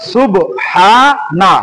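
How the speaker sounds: সুবহানা said very slowly, broken into সুব, হা, না; সুবহানা in drawn-out, detached syllables; syllables separated. Shown as a demonstration. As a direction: stretch and separate each part of সুবহানা. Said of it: "এখন এটাকে আমরা কি করব?".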